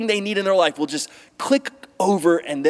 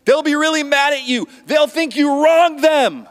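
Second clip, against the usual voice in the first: impassioned pulpit build; rising preacher intensity; 10 semitones higher, 5 dB louder than usual. Real, not acted. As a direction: lift it high and press each word. Preaching climax.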